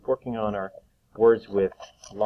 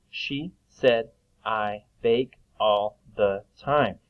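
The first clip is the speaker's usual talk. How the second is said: slow reading aloud; much slower than usual. Shown as a demonstration. Teacher rereads sentence slowly.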